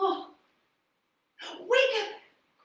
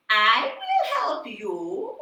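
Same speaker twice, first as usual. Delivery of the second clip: slow, louder magical voice; a voice from the river; mysterious voice; 2 semitones lower, 6 dB louder, slower than usual. Acted. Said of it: "And the voice said".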